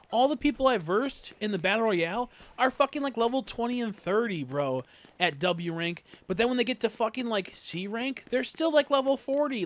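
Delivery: loud, high-pitched ranting complaint; heated rant. Real, not acted. Ranting to friends about the ranking system, loud and high. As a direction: let the frustration climb up high and loud.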